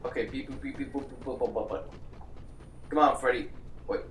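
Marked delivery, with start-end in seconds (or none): 0.0-1.8 beep boop sounds. Retold or imitated. Mimicking keypad beeps softly.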